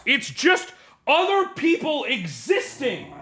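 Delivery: high, drawn-out scolding shout; exasperated yelling. Real, not acted. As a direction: yell in fed-up disbelief at the pet. Yelling at agitated pet.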